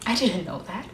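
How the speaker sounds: low, subdued confession; quiet admission. Acted. Monologue, quiet admission of ignorance.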